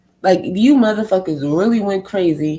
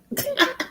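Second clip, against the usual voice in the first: short high laugh; 7 dB quieter than usual. Real, not acted. Laughs at own point.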